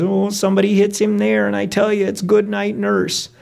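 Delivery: high, sustained worried-mom voice; worried mother. Acted. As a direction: voice the driver's mother fretting up high. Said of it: "Ronnie's mom is worried".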